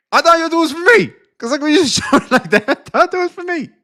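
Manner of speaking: high, fast squeaky mock whine; whining like he's the jilted one; mock jealous whine. Streamer whining to chat it was for him.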